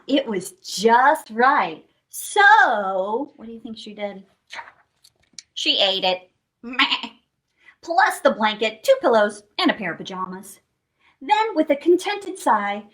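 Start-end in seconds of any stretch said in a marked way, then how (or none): none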